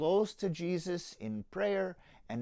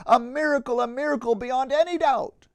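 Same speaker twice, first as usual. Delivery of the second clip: high, louder cry of wonder; 9 semitones higher, 11 dB louder than usual. Acted. Donkey cries out as his body grows strong, excited and high. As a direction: exclaim it, astonished by new strength. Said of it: "the donkey cried out".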